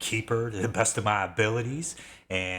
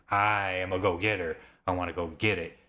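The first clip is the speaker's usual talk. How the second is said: ordinary talk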